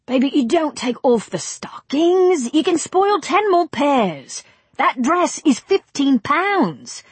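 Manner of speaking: high, slow, scolding director; bossy film director. Acted. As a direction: lecture the actress slowly from above. Director scolds actress on set.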